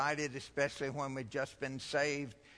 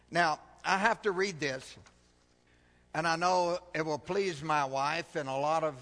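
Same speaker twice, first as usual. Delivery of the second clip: ordinary talk, nothing marked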